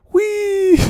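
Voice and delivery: shrill playful squeal; high gleeful whee. Pretend. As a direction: squeal with glee like sliding. Skater gliding again.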